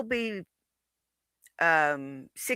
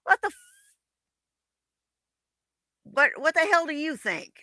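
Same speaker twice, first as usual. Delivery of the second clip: mom voice; 8 semitones higher, faster than usual. Retold or imitated. Acting out mom's reaction.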